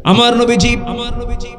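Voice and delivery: high, loud sermon exhortation; full-force preaching. Acted. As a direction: preach it at a sustained high pitch, easing at the end.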